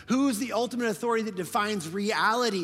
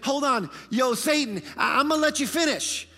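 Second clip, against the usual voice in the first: mock interruption; 7 semitones higher, 6 dB louder than usual. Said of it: "stop the enemy and say".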